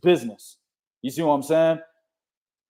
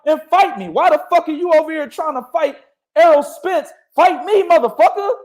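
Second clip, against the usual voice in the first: hammed-up fighter's taunt; mock callout; 8 semitones higher than usual. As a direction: call him out like a fighter. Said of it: "He was like".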